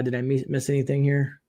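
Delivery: usual speaking voice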